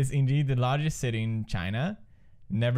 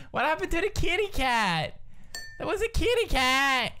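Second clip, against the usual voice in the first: high-pitched, wailing protest; dismayed whine; 13 semitones higher than usual. Real, not acted. Dismayed at losing the cat.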